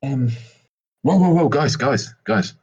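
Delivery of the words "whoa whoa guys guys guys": ordinary talk, nothing marked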